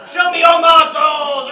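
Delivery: loud cheerful command; rallying shout. Acted. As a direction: pump up the volunteer with a shout. Host cues volunteer to flex.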